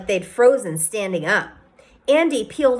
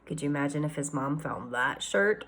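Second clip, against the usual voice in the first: hushed aside; 7 semitones lower, 10 dB quieter than usual. Real, not acted. Reader's aside to listeners.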